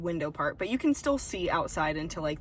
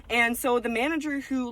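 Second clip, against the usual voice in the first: ordinary talk, nothing marked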